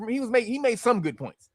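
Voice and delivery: usual speaking voice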